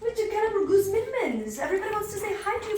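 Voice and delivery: high-pitched singsong coo. Real, not acted. Coaxing Gallop to say hi to everybody, baby talk. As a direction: coax the dog in a high cutesy voice.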